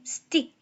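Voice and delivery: "stick" with no puff of air after the t; unaspirated T. Shown as a demonstration. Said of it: "unaspirated 돼서 그냥".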